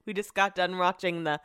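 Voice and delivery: ordinary talk, nothing marked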